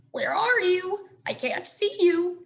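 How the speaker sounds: croaking frog. A narrator's reading. Frog searching for its mate, croaky call. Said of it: "One would croak".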